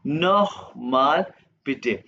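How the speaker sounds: नोखमाल with a throaty kh, very slow; German kh sound. Shown as a demonstration. Teacher demonstrates the kh sound in नोखमाल. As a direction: stretch नोखमाल slowly, throaty kh. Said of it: "खे की आवाज आएगा".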